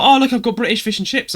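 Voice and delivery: lightly voiced excited pen pal showing off; proud girlfriend quote. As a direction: girlfriend proudly shows her photo, high and eager. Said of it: "she was like".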